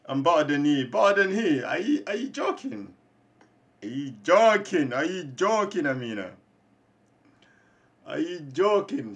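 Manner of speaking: loud, high scoff at a boast; mock the boast, loud; scoffing disbelief. Real, not acted. Gamer scoffing at Amina's boast, loud and incredulous.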